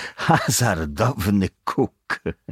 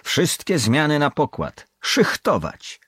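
higher, louder ship's order; captain's command; 9 semitones higher, 4 dB louder than usual. A narrator's reading. Captain calling all watches on deck, commanding. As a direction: call the whole crew on deck. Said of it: "głos kapitana".

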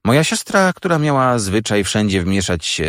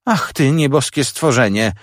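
higher, mildly exasperated exclamation; exasperated cry; 6 semitones higher than usual. A narrator's reading. Sister to the boy who keeps asking, exasperated cry. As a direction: cry out as if biting back worse words. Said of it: "krzyknęła siostra takim głosem, jakby powiedziała co innego".

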